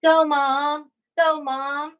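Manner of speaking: cheering. Retold or imitated. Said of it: "cheering me on".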